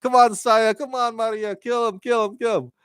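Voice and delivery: high-pitched mock rooting; cheering on. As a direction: egg them on in a high, excited voice. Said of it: "you're rooting for".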